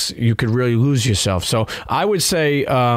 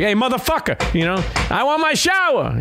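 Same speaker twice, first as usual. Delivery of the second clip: Brooklyn tough guy shout; 12 semitones higher than usual. Retold or imitated. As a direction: yell it like a pissed-off Brooklyn guy. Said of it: "So I'm a Brooklyn guy, so I'm gonna be like".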